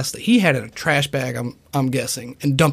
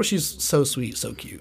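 normal talking voice

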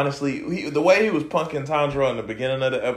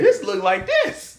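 mock high quote; slower than usual. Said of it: "He said".

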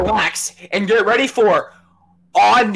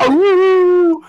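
high squealing shout; high shriek; 10 semitones higher, 5 dB louder than usual. Real, not acted. Wordless shriek.

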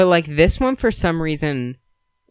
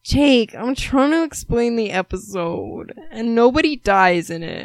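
exasperated; 10 semitones higher than usual. Real, not acted. Exasperated at co-host interrupting.

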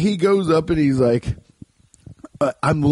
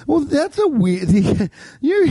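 mocking; 13 semitones higher than usual. Mocking imagined rude worker.

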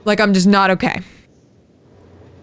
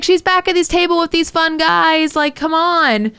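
put-on a coaxing party-girl voice, pitched up; higher, chirpy mock of a tipsy friend; imitating Anna, higher and upbeat; 7 semitones higher than usual. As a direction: sell the other table like a bubbly friend. Anna answering where Stephanie is.

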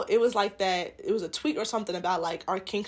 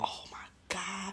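shocked hushed whisper; whispered oh my God; 2 semitones lower, 14 dB quieter, slower than usual. Real, not acted. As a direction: gasp it under your breath. Scandalized reaction.

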